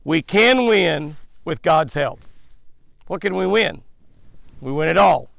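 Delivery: usual speaking voice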